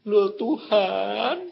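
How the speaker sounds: drawn-out, slow lament to God; bewildered blind man. Retold or imitated. Blind-feeling old man to God on the stairs, slow bewildered lament. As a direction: stretch each word out in dismay. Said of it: "Dia lalu bilang gini".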